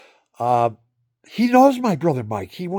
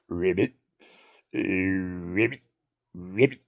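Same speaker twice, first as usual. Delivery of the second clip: lower, mock bullfrog ribbit; bullfrog croak; 8 semitones lower, 5 dB quieter than usual. Old man calls himself a bullfrog, croaking. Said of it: "am I a bullfrog now".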